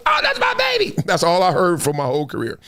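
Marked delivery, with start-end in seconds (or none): 0.1-0.9 high, sing-song mom cheering; a mother squealing with pride; proud mama cheer. Retold or imitated. Mom cheers after his touchdown, high and proud. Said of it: "She like".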